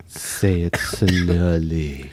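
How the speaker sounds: low and very slow, drawn-out; SpongeBob-narrator style, slow and low; sultry narrator. Acted. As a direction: stretch every word like a late-night narrator. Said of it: "You sound like the narrator of SpongeBob".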